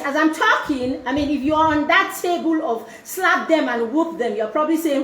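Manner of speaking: usual speaking voice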